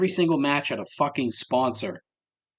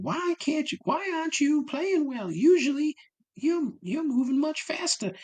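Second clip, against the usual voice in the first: high mocking falsetto of a nagging tennis friend; imitating a woman in the ad scolding her friend, high falsetto; mock woman's voice. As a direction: squeak it up like a prissy friend. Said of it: "on the other one".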